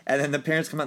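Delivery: usual speaking voice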